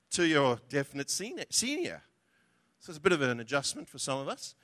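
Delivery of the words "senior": ordinary talk, nothing marked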